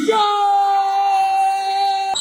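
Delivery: a hint of another announcer's shout; quoting an announcer's "Shot!" in a light put-on voice; mock announcer call. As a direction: bark it like a guest commentator. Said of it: "Mister Robert Trent says".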